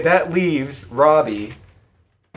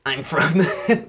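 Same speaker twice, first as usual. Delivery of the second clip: mock Bloda voice; 2 semitones lower than usual. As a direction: tease her voice for Bloda. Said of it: "You sound like".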